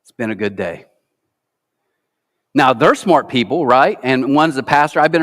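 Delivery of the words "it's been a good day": much softer and lower, gentle; hushed, peaceful calm; quiet, tender. Retold or imitated. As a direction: say it softly like a grieving widower. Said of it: "he said this".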